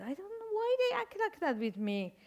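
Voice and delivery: high, puzzled self-voice; hammed-up baffled whine; mock complaining. Mother complaining to her daughter in a high puzzled voice. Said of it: "I said to my daughter".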